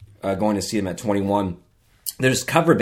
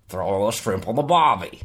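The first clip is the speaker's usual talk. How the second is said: put-on Australian accent, lowered a little; [name]; 3 semitones lower, 4 dB quieter than usual. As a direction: play the Australian giving phrase lessons. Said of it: "how to speak Australian".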